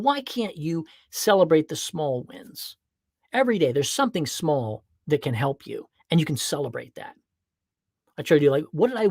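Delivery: ordinary talk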